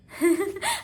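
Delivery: short surprised laugh. Real, not acted. Laughs at own odd word.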